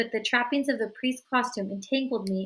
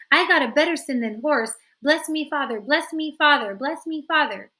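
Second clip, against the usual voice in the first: loud, high begging; eager pleading; 5 semitones higher, 7 dB louder than usual. A narrator's reading. Bones begging the priest for blessing, loud. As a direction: beg the priest over and over.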